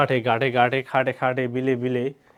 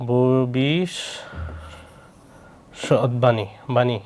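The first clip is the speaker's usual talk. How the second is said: "ভবিষ্যৎবাণী" drawn out very slowly with long breaks; syllable by syllable; 2 semitones lower, much slower than usual. Shown as a demonstration. Teacher working out the word aloud, "ভবিষ্যৎবাণী" syllable by syllable. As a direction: hold "bhobish" of "ভবিষ্যৎবাণী", pause, then finish it. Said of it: "এটা হবে কি?".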